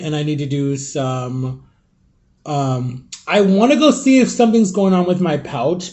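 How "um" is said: normal talking voice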